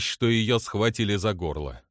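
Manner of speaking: plain reading voice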